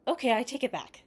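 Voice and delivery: blurting it out keenly; eager. A narrator's reading. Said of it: "said Nia eagerly".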